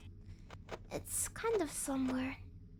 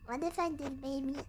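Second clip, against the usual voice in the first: ordinary talk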